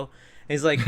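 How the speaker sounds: ordinary talk, nothing marked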